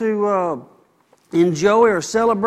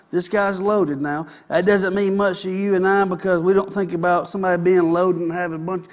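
normal talking voice

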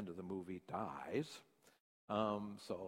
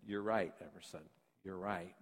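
softer, lower reassuring; gentle reassurance; 5 semitones lower, 4 dB quieter than usual. Retold or imitated. Father reassuring his daughter, soft and tender. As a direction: settle her down tenderly. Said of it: "And I said".

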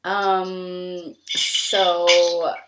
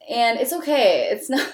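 ordinary delivery

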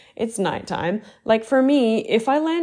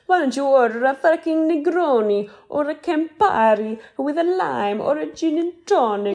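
higher, put-on voice urging a fancier order; mock exasperation at the drink choice, pitched up; imitating Dorit; 8 semitones higher than usual. Dorit chiding Americans about drinks, exaggerated high voice. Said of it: "I'm with Dorit on her".

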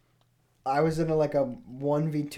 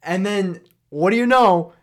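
usual speaking voice

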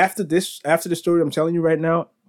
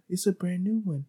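imitating a soft, higher voice; retold quote in a softer, higher tone; soft-spoken; 4 semitones higher, 6 dB quieter than usual. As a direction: softly mimic someone treading carefully. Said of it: "he's speaking very soft".

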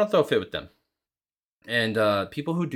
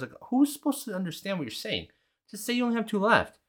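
high-pitched, incredulous complaint; worked-up, pitched-up scolding; exasperated rebuke; 12 semitones higher than usual. Retold or imitated. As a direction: protest as if the answer were obvious. Exasperated rebuke aimed at absent cashier.

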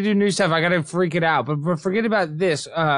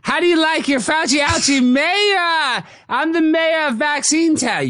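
high, slow put-on mayor voice; hammed-up rapper mayor; goofy character voice; 9 semitones higher, 3 dB louder, slower than usual. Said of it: "I don't know what voice I'm doing".

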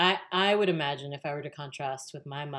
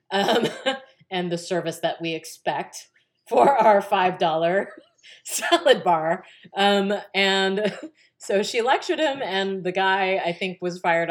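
louder, slow, laughing through words; 4 semitones higher, 9 dB louder than usual. Real, not acted. Telling the salad bar story, laughing loudly.

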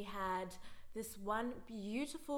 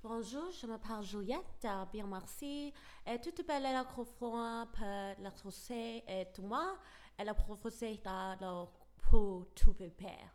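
put-on fluent-sounding French with a French accent; 6 dB louder than usual. Schoolgirl showing off French to her teacher, French accent. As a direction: rattle off French like a show-off. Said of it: "I'm like".

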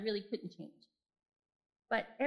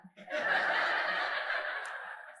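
breathy burst of laughter; real amused laugh. Real, not acted. Laughs at son's remark.

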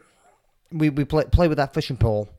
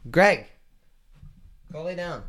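raised, higher call to the cat; 6 semitones higher than usual. Real, not acted. Owner calls his cat Greg off the table, raised voice. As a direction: call his name to stop him.

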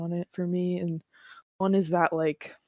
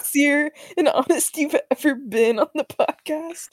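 laughing; 8 semitones higher, 6 dB louder than usual. Real, not acted. Teasing friend through giggles.

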